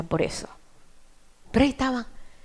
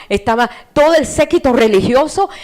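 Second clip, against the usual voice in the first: ordinary talk, nothing marked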